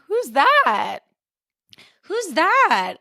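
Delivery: high-pitched mock disbelief; incredulous. Podcaster reacting to actor's changed voice, incredulous. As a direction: pitch it up, utterly baffled. Acting baffled reaction to the accent.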